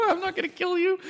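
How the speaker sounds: very high squeaky falsetto; mock-innocent coaxing. Player luring a creature he needs, squeaky coaxing. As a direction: coax it in a tiny high-pitched voice.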